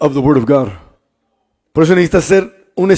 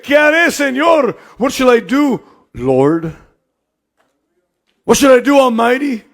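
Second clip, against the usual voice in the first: Saul's desperate plea; 5 semitones higher than usual. Acted.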